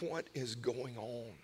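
low, slow, hushed wondering; groggy bewilderment. Retold or imitated. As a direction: mumble it softly, as if just woken. Woken at night by a noise.

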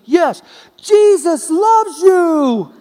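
high, loud and very slow call; shouted from above. Retold or imitated. A man calls down to Steve, slow loud shout. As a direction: stretch it out, calling down from far up.

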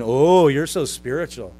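loud, slow, gushing sarcasm; mocking flattery. Preacher as Paul making fun of their bragging, exaggerated sarcastic awe. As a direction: draw out the fake admiration. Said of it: "It's like he's saying".

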